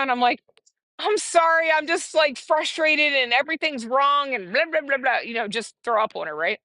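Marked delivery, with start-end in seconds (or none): 1.0-5.2 high-pitched venting at the caller; acted-out exasperated apology tumbling into blahs; retold frustration. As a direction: let the complaint spill out, pitched up. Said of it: "I was so frustrated with the day".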